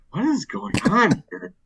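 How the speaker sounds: high baffled disbelief; bewildered. Retold or imitated. To himself, stuck listening to a chili story, high and bewildered. Baffled inner reaction quote.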